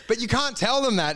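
ordinary talk, nothing marked

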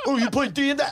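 high, loud excited question; mock delight. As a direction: gush like a nerd spotting a fellow gamer.

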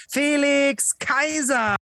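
drawn-out high boxing ring call; ring announcer. Acted. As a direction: stretch the name like a fight announcer. Introducing guest like ring announcer.

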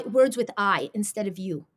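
ordinary talk

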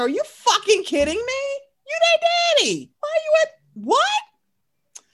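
incredulous, heated rant; getting mad. Real, not acted. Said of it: "See, now I'm getting mad".

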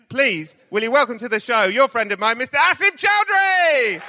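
rising hype announcement; announcer build-up. Real, not acted. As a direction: build to a big guest introduction. Host introduces guest to audience.

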